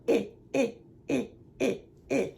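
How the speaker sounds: "Eh" clipped and repeated, nose wrinkled; short i sound. Shown as a demonstration. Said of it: "you kinda wrinkle your nose and you go".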